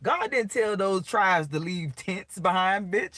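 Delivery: usual speaking voice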